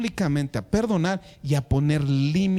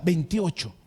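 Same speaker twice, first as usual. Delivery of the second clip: normal talking voice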